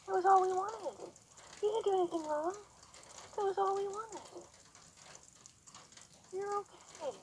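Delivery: high, soft singsong praise; soothing praise to horse. Real, not acted.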